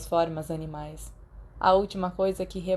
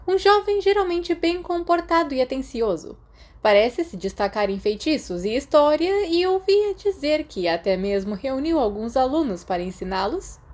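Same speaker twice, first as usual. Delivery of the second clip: much higher, louder, crisp stern headmistress tone; McGonagall voice; 7 semitones higher, 4 dB louder than usual. Acted. McGonagall praises Remus at his careers meeting, higher and louder. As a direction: read out his merits like a strict but pleased teacher.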